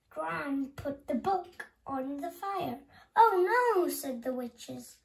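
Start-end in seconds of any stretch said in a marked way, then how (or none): 3.1-3.9 dismayed cry. A narrator's reading. Witches see book burned.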